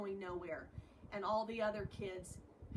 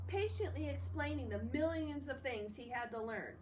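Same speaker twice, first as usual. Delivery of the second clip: reading a novel aloud to the class, a bit higher; story narration; 4 semitones higher than usual. Acted. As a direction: read the chapter to students, clear and steady.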